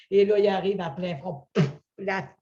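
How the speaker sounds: ordinary talk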